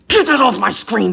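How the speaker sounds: sharp, high yell; shout. Real, not acted. Yells at a gift sender.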